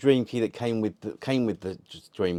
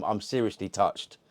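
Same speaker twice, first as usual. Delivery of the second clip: ordinary talk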